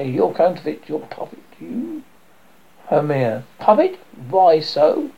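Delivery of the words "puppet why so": outraged surprise. Acted. Hermia hearing herself called a puppet, outraged surprise. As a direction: leap up in pitch at the insult.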